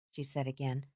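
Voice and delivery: ordinary delivery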